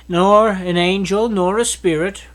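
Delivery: usual speaking voice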